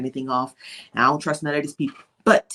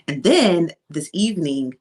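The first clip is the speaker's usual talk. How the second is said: ordinary delivery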